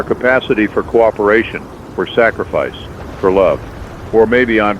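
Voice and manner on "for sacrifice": plain reading voice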